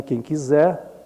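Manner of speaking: normal talking voice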